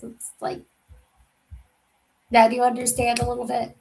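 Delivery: usual speaking voice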